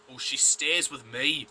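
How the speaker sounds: mock him with a camp sing-song; slow camp mimicry of a creepy guy. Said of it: "Sorry, that was really camp".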